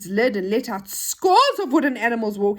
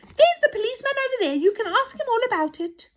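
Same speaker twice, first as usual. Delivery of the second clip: high, quick toy voice; indignant toy; 13 semitones higher than usual. Acted. Toy sends the children to the policeman, high and brisk. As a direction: point someone away in a high, hurried voice.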